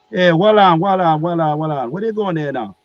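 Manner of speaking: high, loud Jamaican protest; Jamaican accent. Retold or imitated. Archangel Michael challenges Gabriel walking past, Jamaican accent. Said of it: "he had, like, this Jamaican accent".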